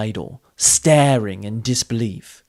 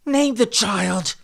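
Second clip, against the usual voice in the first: slow, much higher command; 10 semitones higher, slower than usual. A narrator's reading. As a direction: order it, slowly. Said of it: "Brizza commanded".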